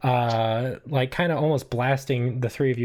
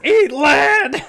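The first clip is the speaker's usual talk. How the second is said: loud, high battle cry; hammed-up gunfight yell; shouting; 10 dB louder than usual. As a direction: shout it like a mobster opening fire. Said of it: "He's just shouting it over and over again".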